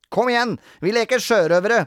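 put-on high, loud excited child; rally friends for a game; boisterous boy; eager urging. A narrator's reading. Robin rallies playmates.

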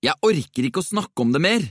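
high-pitched, exasperated outburst; irritated snap. A narrator's reading. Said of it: "hveste han og tømte colaen". (irritert og oppgitt utbrudd)

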